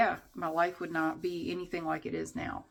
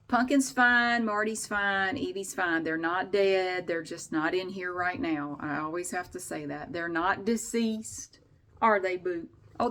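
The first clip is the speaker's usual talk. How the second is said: ordinary delivery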